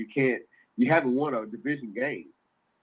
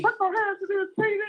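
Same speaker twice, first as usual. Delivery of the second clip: high squeaky mock whine, much higher; whiny falsetto impression of a complaining fan; mocking Reggie. Teasing a Colts fan with a whiny squeal. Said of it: "He's gonna say".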